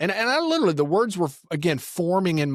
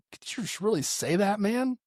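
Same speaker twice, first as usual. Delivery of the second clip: a higher, incredulous question; stunned disbelief at the remark; 8 semitones higher, 3 dB quieter than usual. Retold or imitated. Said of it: "I was like".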